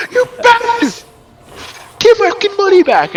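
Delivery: high, loud, cracking furious yell; furious shout. Acted. Harlem screams at the bandit for his money, furious. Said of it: "Says and goes".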